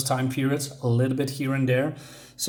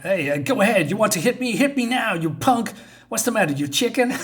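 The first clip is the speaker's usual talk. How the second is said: higher mock taunt, daring him to hit; goading; 7 semitones higher than usual. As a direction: egg him on like a schoolyard bully.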